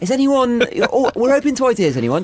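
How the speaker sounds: high, upbeat corporate manager voice; a mock manager fishing for ideas; chirpy boss. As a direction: chair the meeting like an eager cheerful boss. Mock board meeting boss.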